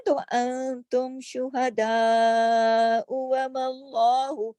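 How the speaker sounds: शुहदा flowing straight into व मल्लाहु; connected chanted reading; joined recitation. Shown as a demonstration. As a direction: run the words together slowly. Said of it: "अगर मिला के पढ़ते हैं".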